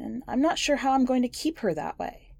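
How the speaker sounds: ordinary delivery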